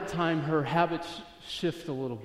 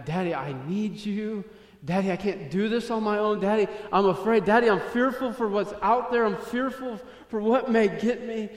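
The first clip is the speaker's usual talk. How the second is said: put-on higher, urgent pleading as a frightened child; crying out to Daddy; 6 semitones higher, 5 dB louder than usual. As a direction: imitate a child's fearful plea, building in pitch. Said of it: "crying out to Daddy".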